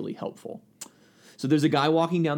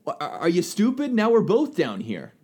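higher, incredulous scolding; acted exasperation; 6 semitones higher than usual. Man in the hole to friend who jumped in, exasperated. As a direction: react in mock disbelief at a foolish friend.

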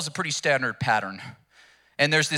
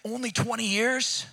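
high, eager bargaining man; higher pitch, grabbing every extra year; a man greedily bargaining for more life; 7 semitones higher than usual. Acted. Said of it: "the man's like".

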